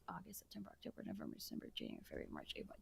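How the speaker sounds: whispered rapid-fire list to yourself; counting under breath. Real, not acted. Host working out the school year's length, whispered counting. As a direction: whisper the months as you tally them.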